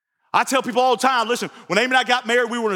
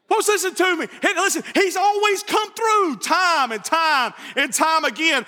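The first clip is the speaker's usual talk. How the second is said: high, slow, shouted declaration; preaching shout; 5 semitones higher than usual. Real, not acted. Preacher building to a climax.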